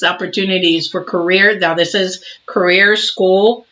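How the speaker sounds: ordinary talk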